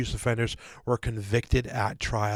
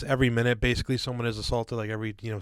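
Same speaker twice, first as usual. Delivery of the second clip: ordinary talk, nothing marked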